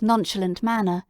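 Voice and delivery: ordinary delivery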